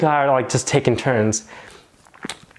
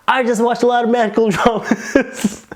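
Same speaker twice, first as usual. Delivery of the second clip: laughing joke; 10 semitones higher than usual. Retold or imitated. Joking answer, laughing mid-line.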